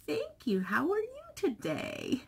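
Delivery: imitating a chipper grandmother; high grandma voice. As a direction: lift the pitch, unaware and sweet. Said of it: "She's like".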